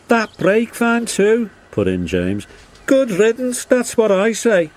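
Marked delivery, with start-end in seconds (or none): 0.1-1.5 high, loud, slow engine voice; indignant James. A narrator's reading. James chimes in, acted voice. 2.9-4.7 very high, loud engine voice; dismissive James. A narrator's reading. James chimes in, acted voice.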